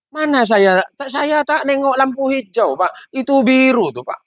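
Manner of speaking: put-on high Madurese accent, arguing; Madurese driver. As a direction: protest like a Madurese villager, pitched high. Madurese driver argues back. (logat Madura tinggi, ngotot)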